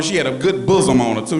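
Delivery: normal talking voice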